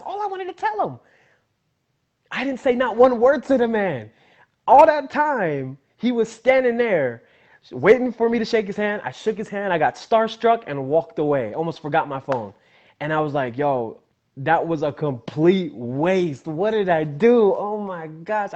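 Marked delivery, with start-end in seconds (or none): 0.0-9.0 high, loud self-reproach; exasperated outburst. Retold or imitated. Fan regretting his silence before his idol, loud exasperation. 13.6-18.5 slow, drawn-out anguished lament; upset with himself. Retold or imitated. Starstruck fan after walking away, upset at himself. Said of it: "I was so upset".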